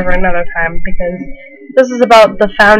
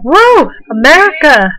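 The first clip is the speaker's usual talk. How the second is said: high, whooping imitation of a patriotic crowd; playful high-pitched victory cheer; mock crowd cheer; 6 semitones higher, 3 dB louder than usual. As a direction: whoop it up like everyone celebrating. Everyone cheers for America's win.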